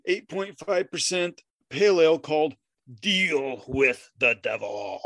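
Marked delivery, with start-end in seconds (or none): 3.0-5.0 very slow, drawn-out title; slow theatrical menace; mock ominous. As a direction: intone it like a dark omen. Naming the beer dramatically.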